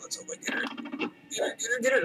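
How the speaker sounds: breathless whisper, then a rising urge; tense whisper. Real, not acted. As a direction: whisper it, willing the hit. Hoping to hit Catwoman.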